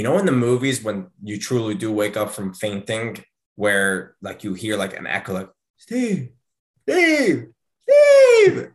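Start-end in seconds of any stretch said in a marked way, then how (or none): 5.8-8.7 building from a high call to a much higher, louder shout; echoing call growing louder. Retold or imitated. Wife calling her fainted husband back, rising to a shout. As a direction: call his name from far off, then louder and louder. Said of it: "And it was, and it got louder".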